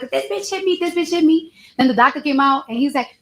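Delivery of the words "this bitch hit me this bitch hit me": high, frantic mock accusation; mimicking a wild accusation. Witness imitating the receptionist yelling accusations, high frantic mimic. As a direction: act out the receptionist losing it, pitch way up. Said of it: "she just started wilding out".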